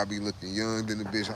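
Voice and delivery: usual speaking voice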